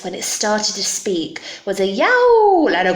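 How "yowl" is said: loud, high, stretched yowl; animal yowl. Acted. Beast's terrible voice described, loud high yowl. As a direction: yowl the word like a cat. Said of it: "its terrible voice".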